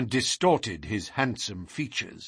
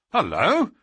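a sudden raised hullo; surprised cry; 5 semitones higher, 3 dB louder than usual. Acted. Inspector notices Holmes has vanished, mildly surprised cry. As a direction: call out, caught off guard. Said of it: "cried the inspector".

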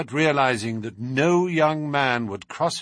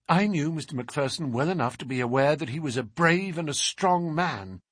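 plain reading voice

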